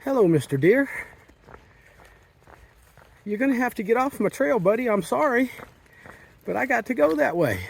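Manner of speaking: high, soft coaxing voice; talking to an animal. Real, not acted. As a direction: gently shoo a deer aside. Asking deer to leave the trail.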